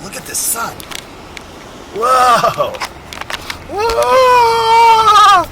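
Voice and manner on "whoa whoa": high, loud drawn-out whoa; big high-pitched yell of amazement; astonished shout. Real, not acted. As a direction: cry out, rising into a long high whoop. Reacting to dazzling bright sun.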